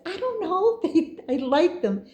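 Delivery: high, giggly embarrassment; embarrassed giggle. Retold or imitated. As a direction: laugh off the question nervously. Her own sheepish reply retold.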